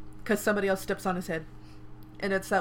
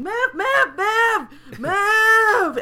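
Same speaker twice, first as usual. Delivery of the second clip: much higher, louder panicked yelling of move; screaming crowd; 13 semitones higher, 11 dB louder, much slower than usual. Retold or imitated. Retelling a crowd scene to a friend, panicked shouting. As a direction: crowd shoving through in panic. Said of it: "people are screaming".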